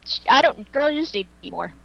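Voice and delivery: ordinary delivery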